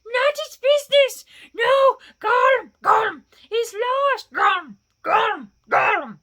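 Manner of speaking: high, loud, sobbing screech; anguished gulping creature cries; Gollum shriek. A narrator's reading. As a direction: wail it, breaking into loud throat gulps. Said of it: "shrieked Gollum".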